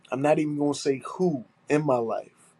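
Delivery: ordinary talk, nothing marked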